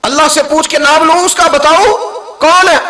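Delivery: high, loud impassioned preaching; preaching at full force. Acted. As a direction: call it out to the crowd with fire. (پرجوش بلند خطیبانہ انداز)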